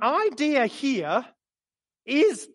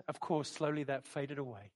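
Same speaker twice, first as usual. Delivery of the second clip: much lower and softer, trailing off; fading away; 8 semitones lower, 14 dB quieter than usual. Real, not acted. Preacher recalling the vision fade, dropping soft and low. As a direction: let it fade to almost nothing.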